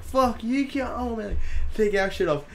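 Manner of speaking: high, frantic mock protest; hammed-up high outcry; retold protest. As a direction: imitate drunken friends objecting. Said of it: "and we're like".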